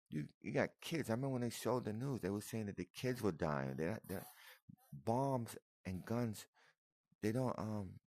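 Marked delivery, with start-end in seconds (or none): 0.1-1.1 pleading. Real, not acted. Pleading with attackers. 4.9-6.5 slow emphasis. Real, not acted. Slowed for emphasis.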